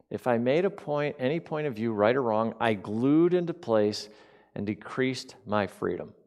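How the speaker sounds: slow pondering reread. Shown as a demonstration. Pastor rereading the quote to the congregation, slow and thoughtful. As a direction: mull each phrase over slowly as if weighing it. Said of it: "Wait a minute".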